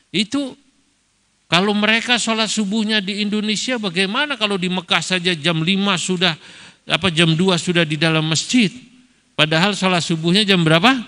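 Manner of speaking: high, wondering question. Retold or imitated. Moroccan cleric asks Kiai Ma'ruf, high and puzzled. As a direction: ask it high and baffled. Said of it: "kiainya Maroko bertanya".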